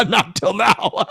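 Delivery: can't get the words out without laughing; laughing reply. Retold or imitated. Answering his dad about the lobby hug, laughing. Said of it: "I go".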